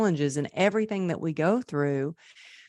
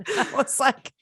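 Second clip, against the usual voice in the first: words through a high laugh; laughing, pitched up; 9 semitones higher than usual. Real, not acted. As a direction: say it while still giggling, much higher. Still laughing after the quote.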